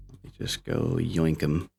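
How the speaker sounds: ordinary talk, nothing marked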